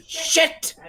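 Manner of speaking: sudden high-pitched yelp; short, high cry of shock; startled curse. Acted. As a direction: blurt it out in alarm. Game master voices startled cry.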